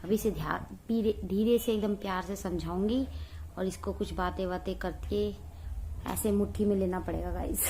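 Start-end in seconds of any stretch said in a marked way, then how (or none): none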